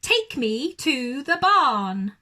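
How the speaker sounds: loud, slow, drawn-out order; loud demand. Acted. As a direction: stretch the command out grandly. Owl ordering duck.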